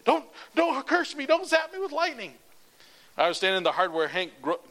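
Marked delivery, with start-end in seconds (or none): 0.1-2.3 very high, loud mock panic; shrieking hammed-up fright; mock terror. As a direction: beg not to be cursed, hamming it up. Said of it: "he'd be like".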